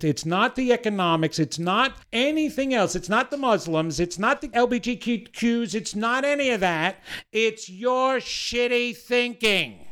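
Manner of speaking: high, insistent motivational climax; building emphatic insistence. Real, not acted. Climax of motivational list of excuses.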